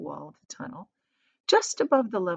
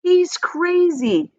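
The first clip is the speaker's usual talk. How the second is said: high, loud, slow outraged shout; parents shouting; 11 semitones higher, 7 dB louder, much slower than usual. A narrator's reading. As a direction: yell it at the madman. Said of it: "they shouted".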